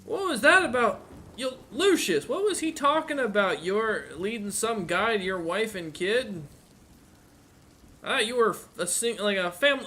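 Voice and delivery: [name] talking high and loud in a casual, confused way; curious guard quizzing Lucius about his family. Acted. [name] asks Lucius about his wife and kid, puzzled and high. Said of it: "he just looks back at you and, uh, and goes".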